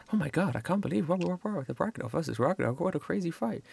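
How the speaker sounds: quick, breathless gossiping patron; excited bar patron. Acted. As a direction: gush about the fight. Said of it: "You hear some of them go like".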